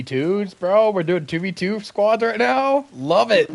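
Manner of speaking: excited. Real, not acted. Excited about small squads.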